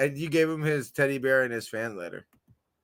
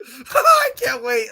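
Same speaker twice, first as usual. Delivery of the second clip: squealing with laughter, much higher and slow; giddy laughing; 8 dB louder, much slower than usual. Real, not acted. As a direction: laugh it out, overjoyed. Overjoyed about football season.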